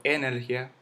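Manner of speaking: "energía" stressed on its first E, E-ner-gia; mis-stressed "energía" as Énergia; wrong first-syllable stress. Shown as a demonstration. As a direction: hit the opening E of "energía". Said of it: "yo no digo".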